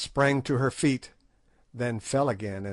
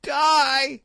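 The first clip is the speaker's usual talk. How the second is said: high, loud, frightened, furious crone shriek; old witch shrieking back at a death threat; shriek of terror and fury; 6 dB louder than usual. A narrator's reading. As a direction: scream the word in outraged fear. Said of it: "she shrieked in terror and fury".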